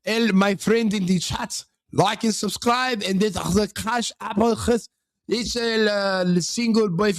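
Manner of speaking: slow, hammed-up mock Somali accent; fake Somali accent. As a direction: pitch the chat in a faked Somali accent. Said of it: "gonna use a Somalian accent".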